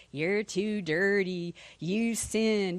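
slow, drawn-out taunting devil; devil's slow accusing voice. Acted. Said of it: "my devil voice again".

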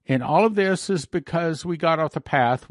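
ordinary delivery

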